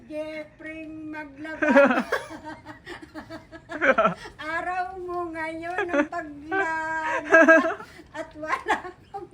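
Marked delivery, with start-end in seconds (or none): 1.7-9.3 laughing. Real, not acted. Mother laughing while teasing.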